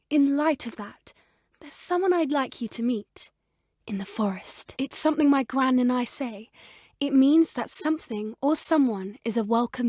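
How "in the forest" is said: unvoiced secretive whisper; whispered hint. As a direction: let them in on a secret. Secretive aside.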